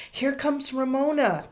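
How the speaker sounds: put-on high, loud kids' call; shouted warning. A narrator's reading. Children seeing Ramona coming, shouting. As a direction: shout it out across a playground. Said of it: "the other boys and girls shouted".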